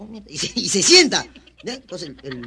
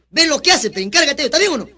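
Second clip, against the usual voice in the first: raised, higher commanding voice; mock stern order; 5 semitones higher, 6 dB louder than usual. Professor sending a man to take over the class, raised mock-stern order. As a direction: give the order loud and high.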